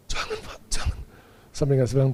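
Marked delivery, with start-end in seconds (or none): 0.1-1.0 high, soft pleading whimper; acted faint plea. Pastor still refuses the foot washing, soft acted plea. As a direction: imitate a weak, helpless protest.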